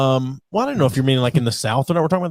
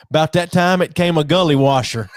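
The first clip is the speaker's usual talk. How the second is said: put-on Southern aunt's accent; 4 semitones higher, 3 dB louder than usual. Quoting wife's aunt's storm post, Southern accent. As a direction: read it out as a Southern aunt would talk. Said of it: "posts their things on, like, Facebook the way they talk".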